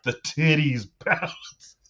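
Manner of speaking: slow, high gushing with a laugh breaking in; gushing reviewer. Retold or imitated. Quoting the writer after turning the page, mock gushing.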